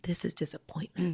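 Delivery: hushed, breath-soft admission; name the hurt almost in a whisper; tender realization. Acted.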